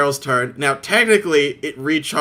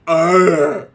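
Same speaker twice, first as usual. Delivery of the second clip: zombie groan; 5 semitones higher, 8 dB louder than usual. Retold or imitated. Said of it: "it just goes".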